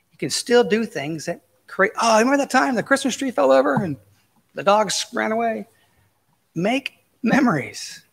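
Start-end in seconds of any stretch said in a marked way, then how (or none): 2.0-5.7 high, excited voice recalling a memory; mock cheerful memory; fond reminiscing. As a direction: play a delighted family member remembering. Mimics someone sharing a memory.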